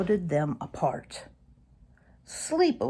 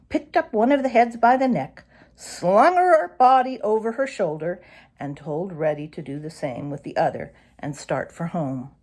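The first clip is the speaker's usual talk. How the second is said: plain reading voice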